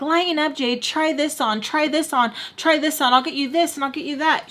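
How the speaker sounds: high, rushed, repetitive urging; manic mom. Retold or imitated. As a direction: imitate an overexcited mother pushing clothes. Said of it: "She's having a manic episode".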